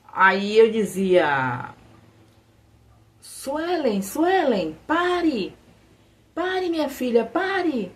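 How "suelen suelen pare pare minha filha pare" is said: put-on high pleading cries to a runaway child; retold dream shout. Mother calling her daughter away from the edge, high pleading cries. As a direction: call her back, pitched high. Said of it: "e eu gritando".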